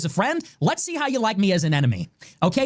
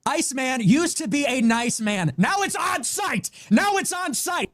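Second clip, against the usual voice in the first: shouting; 5 semitones higher than usual. Real, not acted. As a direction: yell it as a threat. Declaring war, shouting.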